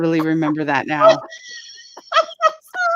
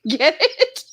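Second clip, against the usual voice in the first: much higher, laughing through the words; imitating laughing; slower than usual. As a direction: mimic her cracking up at her own joke. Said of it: "She would die laughing".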